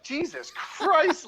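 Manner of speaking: high, slow laughing exclamation; laughing disbelief. Retold or imitated. Startled reply, laughing.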